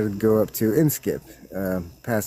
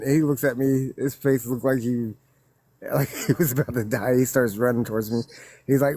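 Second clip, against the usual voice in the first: normal talking voice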